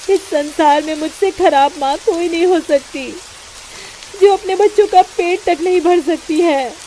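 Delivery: put-on mother lamenting she cannot feed her children; despairing prayer. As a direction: cry out to God in despair.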